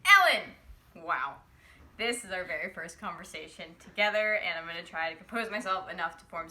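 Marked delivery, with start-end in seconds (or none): 0.1-0.4 excited shout. Real, not acted. Fan calling out Ellen's name.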